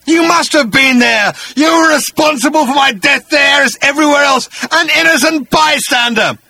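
high, loud outraged shout; quivering outrage. Acted. Agrajag insisting Arthur caused his death, outraged high shout. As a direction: shout it as the wronged victim. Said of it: "he quivered".

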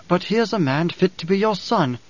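silky soft, soothing. Acted. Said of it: "her voice was silky soft, soothing".

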